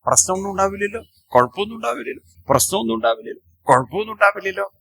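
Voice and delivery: put-on high, fast, fretful pestering like a scared child; a timid bridegroom keeps pestering his protector, anxious and fast; nervous nagging groom. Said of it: "ഇടയ്ക്കിടയ്ക്ക് ചോദിക്കും".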